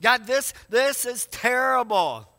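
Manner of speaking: loud, slow cry of complaint to God; lamenting. Acted. Psalmist complaining to God in prayer, loud lament. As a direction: protest to God, stretching the words. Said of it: "Fifty of them are laments".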